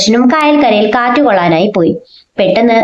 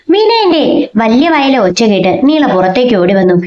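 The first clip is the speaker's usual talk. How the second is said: usual speaking voice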